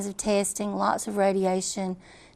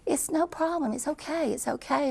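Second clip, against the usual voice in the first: soothing doctor; 7 semitones higher, 4 dB quieter than usual. Retold or imitated. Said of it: "he says".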